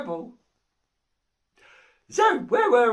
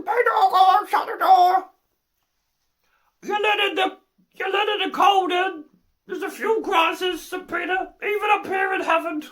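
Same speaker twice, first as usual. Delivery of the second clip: higher, louder mock shout across a room; calling out; 7 semitones higher, 4 dB louder than usual. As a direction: holler a complaint to someone at the door. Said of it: "they're all calling him".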